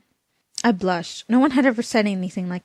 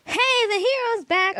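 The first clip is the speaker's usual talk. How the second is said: mock hero shout; 14 semitones higher than usual. A narrator's reading. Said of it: "America shouted".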